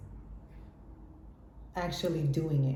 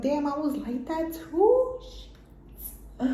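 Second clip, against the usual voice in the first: much higher, startled "Damn"; shocked realization; 9 semitones higher, much slower than usual. Retold or imitated. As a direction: catch yourself in disbelief. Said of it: "I'm like".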